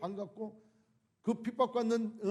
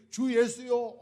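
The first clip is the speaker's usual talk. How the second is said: fervent cry; 3 semitones higher, 7 dB louder, slower than usual. Retold or imitated. Quoted cry of persecuted believers.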